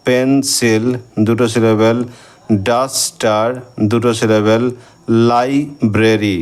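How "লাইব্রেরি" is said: Library stretched very slowly, parts held apart; slow teacher's syllable demo. Shown as a demonstration. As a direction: break library into beats for the class. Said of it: "এখানে আমরা দুটো syllable পাচ্ছি".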